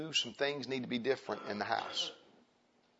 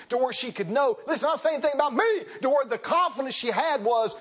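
loud, higher hammering sermon voice; full-force preaching; 12 semitones higher, 7 dB louder than usual. Acted. Preacher exhorting the men of his church, loud and driving. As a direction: drive it home to the congregation.

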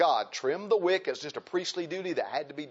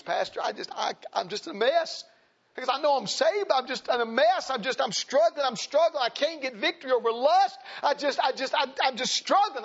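higher-pitched, agitated confession; worked-up, halting plea in a raised pitch; distressed young man; 9 semitones higher than usual. Retold or imitated. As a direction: act out a struggler pouring it out to the pastor. Retold troubled young man confessing.

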